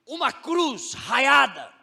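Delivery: pregação crescente, mais alta, lenta nas respostas; preaching. Acted. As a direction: martele cada resposta para a igreja. Preacher climaxes on flags. (pregação em crescendo, bem mais alta)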